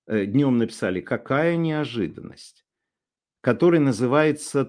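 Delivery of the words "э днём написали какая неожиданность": ordinary talk, nothing marked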